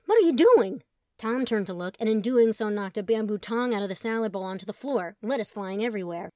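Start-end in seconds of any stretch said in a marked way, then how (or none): 0.0-0.8 loud, high shout; yelling. A narrator's reading. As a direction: yell at the chaos. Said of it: "Colin yelled".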